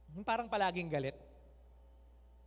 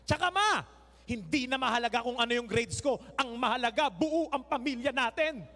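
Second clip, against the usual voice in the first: put-on louder, earnest child talking back; mock defiant child; 5 dB louder than usual. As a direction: answer mom with a righteous speech. Child answering mom defiantly.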